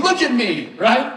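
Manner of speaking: a dad demanding attention; stern, direct, eyes-on-me tone; lightly played firm father. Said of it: "This is me to my daughter when she's not listening".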